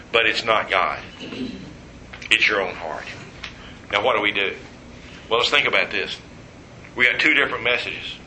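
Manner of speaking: usual speaking voice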